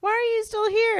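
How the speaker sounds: put-on high squeaky kid voice; imitating a tiny squeaky voice; little girl voice. Aunt imitating her niece's squeaky little voice. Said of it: "that little itsy bitsy voice".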